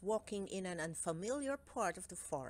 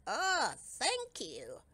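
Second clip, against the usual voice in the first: slow, unconvinced thanks; doubtful; 5 semitones higher, much slower than usual. A narrator's reading. Cowslip the gnome called the first dimwit caught, slow and doubtful. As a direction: drag it out, not sure it's a compliment. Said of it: "replied Cowslip doubtfully".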